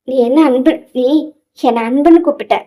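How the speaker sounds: plain reading voice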